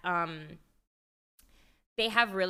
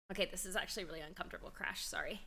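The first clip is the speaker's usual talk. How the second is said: hushed; 3 semitones lower, 10 dB quieter, much faster than usual. Real, not acted. Dog crashing, uncomfortable.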